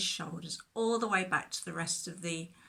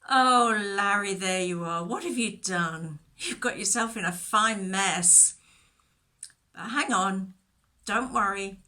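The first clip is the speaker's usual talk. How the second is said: loud, relieved calling down the cliff; 9 dB louder than usual. Acted. Shepherd calls loudly down to the lamb stuck in the bush. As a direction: call down to reassure him from above.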